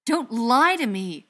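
furious, slow yell. A narrator's reading. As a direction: yell it, stretching the words. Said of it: "the butcher yelled".

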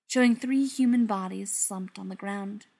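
plain reading voice

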